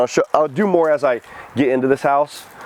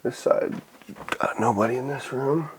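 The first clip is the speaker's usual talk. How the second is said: whispering; 8 dB quieter than usual. Real, not acted. Checking rooms quietly.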